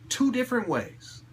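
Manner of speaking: ordinary talk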